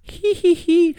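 put-on high little-girl giggle; giggling. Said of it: "ria-se a Millie". (risinho agudo de menina)

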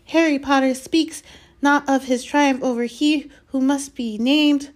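high, loud elf voice in awe; reverent house-elf. A narrator's reading. Dobby marvelling at Harry's triumph, reverent. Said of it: "said Dobby reverently".

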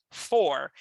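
Four ending in a rolled-back country R, "forr"; country R demo. Shown as a demonstration. Teacher modeling the country R in Four. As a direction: give Four a strong back-of-mouth caipira R. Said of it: "esse R de matuto".

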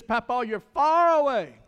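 put-on light child's voice calling to Papa; imitating grandson. Grandson in Hawaii telling Papa he's far away, lightly voiced child. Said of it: "he says".

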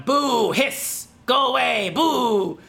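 playful boo and hiss at a snake; mock scaring a snake. To the snake on the field, a playful mock scare. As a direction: shoo it off with a fake spooky boo. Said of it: "fake scaring the snake".

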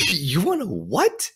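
slow, high-pitched mock disbelief; incredulous mom. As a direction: stretch it out like a baffled parent. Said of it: "Like any mom to a thirteen-year-old kid".

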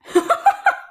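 high-pitched squeaky laugh; high emotional laugh. Real, not acted. Fan overcome by a favourite scene, squeaky laugh.

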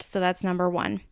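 usual speaking voice

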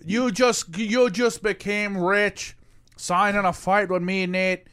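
slow, drawn-out Irish brogue; Conor McGregor Irish accent. Retold or imitated. Fighter telling his opponent he just became rich, mock Irish accent. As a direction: imitate an Irish fighter's accent, stretching the words. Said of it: "his Conor McGregor imitation".

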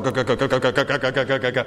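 rapid stuttering imitation of shaking brakes; rattling "guh-guh-guh" car impression; juddering car noise. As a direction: imitate a car shuddering in a steady machine-gun rhythm. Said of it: "the car starts going".

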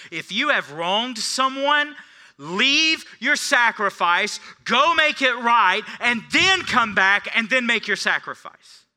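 loud, high, slow pulpit proclamation; preaching at full force. Acted. Pastor thundering Jesus' command at the congregation, loud and slow. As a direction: hammer each command home to the room.

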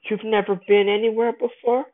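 high, far put-on teasing voice of Tatiana. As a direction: tease him in a high, flirty lilt. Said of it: "and she's like".

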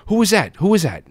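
high, startled question; just woken, demanding to know. Retold or imitated. Father just woke up.

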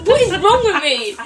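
high, loud self-mocking cry; exasperated laughing outburst. Real, not acted. Player mortified by own blunder, bursting out high and loud.

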